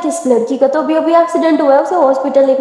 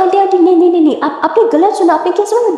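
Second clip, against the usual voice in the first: panicked; 5 semitones higher, faster than usual. Acted. Girl frantically denying her insult to the aunt. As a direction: rush to take it back, alarmed.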